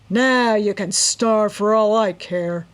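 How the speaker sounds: loud, slow rough cruel taunt; harsh gloating. A narrator's reading. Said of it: "the man laughed harshly".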